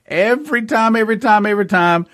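laughing. Real, not acted. Laughs while repeating.

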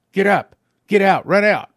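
urgent command. Retold or imitated. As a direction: order him out of bed with force. Angel commands him to rise.

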